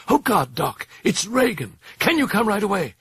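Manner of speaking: distraught, high-pitched plea; high voice on the brim of hysteria; near hysteria. A narrator's reading. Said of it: "on the brim of hysteria".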